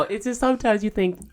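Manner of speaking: usual speaking voice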